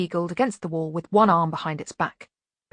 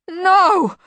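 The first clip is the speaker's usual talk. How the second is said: very high, loud wail; despairing bogeyman howl; horrified wail; 12 semitones higher, 9 dB louder than usual. A narrator's reading. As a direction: shriek it in utter horror. Bogeyman horrified by fluffy bunnies.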